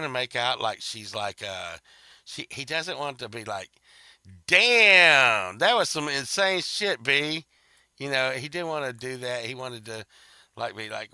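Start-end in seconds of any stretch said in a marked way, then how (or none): 4.5-7.5 hammed-up stunned exclamation; mock host outburst. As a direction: burst out louder and higher, amazed. Host's imagined blunt reaction.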